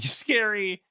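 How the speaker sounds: usual speaking voice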